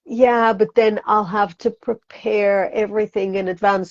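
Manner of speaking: ordinary delivery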